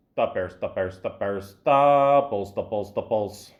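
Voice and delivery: lightly voiced superfan accent; mock Chicago fan. Riffing on Chicago fans in a mild superfan voice. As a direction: ham up a Chicago sports fan.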